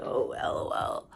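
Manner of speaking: a breathy, unimpressed hushed aside; brushing off his bragging in a hushed whisper; dismissive whisper. Retold or imitated. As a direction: whisper it like a private eye-roll. Shrugging off his flirting.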